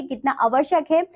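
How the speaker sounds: ordinary talk, nothing marked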